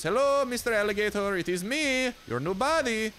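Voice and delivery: high, drawn-up hello to a gator; playful mock greeting. Hunter hailing Mister Alligator, playful mock greeting. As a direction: greet the prey in a high, silly sing-out.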